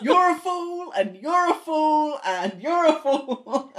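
high, laughing taunt, each fool stretched; mocking sing-song. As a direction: tease them with a giggly chant. Mocking repeat of the insult.

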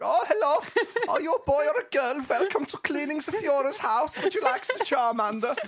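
put-on very high, loud camp German accent; hammed-up, sing-song camp German greeter. Goebbels welcoming a new Pokemon player, camp German accent. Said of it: "had a really camp German voice".

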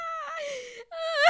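high, cry-like laughing fit. Real, not acted. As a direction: break into shrieking laughter. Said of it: "I just turned into, like, an old person".

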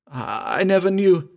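halting start leaping high and loud; shocked cry. A narrator's reading. Patou learning of Myra's husband, shocked cry. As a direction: cry out, stunned, after a stammer. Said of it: "cried Patou".

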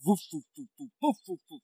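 repeated rhythmic "voof" of rotor blades; imitated propeller whooshes. Co-host describing lights turning into helicopter sound, mimicking propeller whooshes. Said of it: "propellers going".

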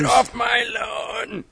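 high, slow mock yell; hammed-up cranky shout; grumpy old man. Mocking a cranky old man yelling at kids.

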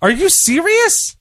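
high, incredulous outburst; can't believe what he heard; shocked disbelief. Real, not acted. Shocked at friend's pick.

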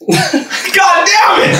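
busted on air, laughing groan. Real, not acted. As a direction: groan it out through a laugh, drawn out slowly. Groans at embarrassing story question.

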